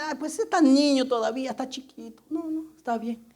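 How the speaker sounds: soft mock coddling voice, fading very quiet; indulgent parent. Mock parent softly excusing a little child from church. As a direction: lightly imitate a doting parent excusing the child.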